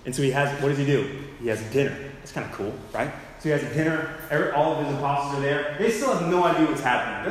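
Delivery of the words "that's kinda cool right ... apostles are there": ordinary talk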